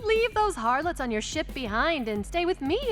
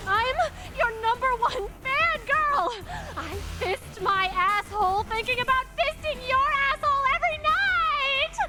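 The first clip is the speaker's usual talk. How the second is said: unhinged fangirl; 7 semitones higher than usual. Acted. Number one fan confessing to Captain, frenzied. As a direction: build higher until the last words are a shriek.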